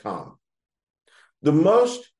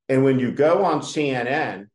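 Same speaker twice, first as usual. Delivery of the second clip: usual speaking voice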